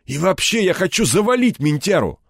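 high-pitched, boiling outburst; seething malice. A narrator's reading. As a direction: throw it out with rage bursting through. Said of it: "злоба в нём клокотала и рвалась наружу". (с клокочущей злобой, на повышенных тонах)

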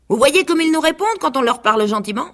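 high, proud rooster voice. A narrator's reading. Rooster demanding his seed from the parents, high and proud. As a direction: crow out the demand.

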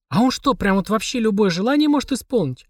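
put-on high, wide-eyed little girl; gasping amazement. A narrator's reading. Mashka asking if he can grant any wish, amazed. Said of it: "ахала Машка".

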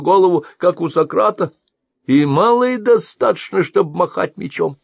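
put-on high, loud sneering old trader; mocking blind merchant. A narrator's reading. Blind merchant mocks the mercenary's huge helmet, sneering. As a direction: jeer at the big helmet.

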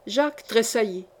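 usual speaking voice

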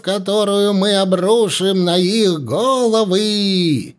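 high, slow, ecstatic shaman cry; shaman shouting prophecy. A narrator's reading. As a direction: proclaim the prophecy like a possessed seer. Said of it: "прокричал". (шаман выкрикивает пророчество)

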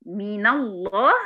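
"من الله" slowly, the nun opened with a fatha; correct recitation. Shown as a demonstration. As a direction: link "من" into "الله" as "mina llah", slow. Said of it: "يبقى من".

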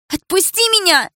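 high, loud cry to be let go; screaming. A narrator's reading. Milana screaming as Dilara pulls her hair. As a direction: yell while being yanked by the hair. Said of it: "закричала Милана".

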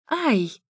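short high yelp; pained squeal. A narrator's reading. Narrator banging her finger on the wall, high yelp. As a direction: squeal at sudden pain. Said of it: "взвизгнула я".

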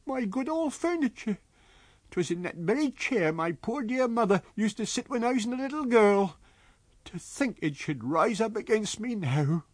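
put-on high, agitated old landlady voice; frightened landlady. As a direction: lament it high and shaken.

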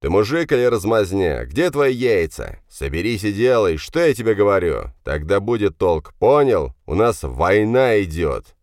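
loud, hard father's lecture; angry dressing-down. Acted. Father berates his tearful son. (громкий сердитый разнос)

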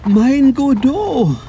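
the name hits him like a blow; shocked gasp. Acted. Man recognises his own stage character, stunned.

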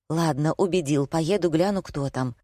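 ordinary delivery

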